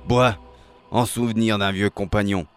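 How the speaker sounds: put-on huge, loud old seaman; thundering toast from a giant sailor; monstrous sailor voice. A narrator's reading. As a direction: order a drink in a monstrous, booming voice. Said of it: "d'une voix monstrueuse".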